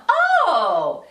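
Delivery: high-pitched mock-innocent passive aggression; passive aggressive. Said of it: "That's passive aggressive".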